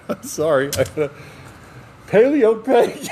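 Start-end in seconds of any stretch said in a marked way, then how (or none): 2.1-3.1 a high, loud, slow blurt of the punchline; shouted punchline. Real, not acted. Cracking up while shouting his own joke's punchline, high and loud. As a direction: belt the punchline out, laughing at yourself.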